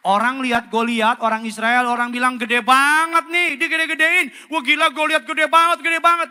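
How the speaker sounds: loud, high, fervent sermon; preaching at full force. Acted. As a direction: drive it home to the whole church. Preaching, mocking fearful Israelites.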